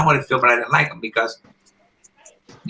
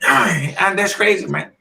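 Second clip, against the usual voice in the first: ordinary talk, nothing marked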